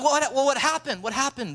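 high, incredulous coach; reenacted dismayed questioning; urgent questioning. Coach questions a losing kid.

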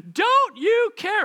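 high, loud, drawn-out cry; shriek. A narrator's reading. Drowning disciples question Jesus, shrieking desperately. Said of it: "I like the word shrieked".

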